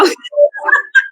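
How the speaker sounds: real high-pitched laughter. Real, not acted. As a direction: burst into a high giggle. Laughing at her own story.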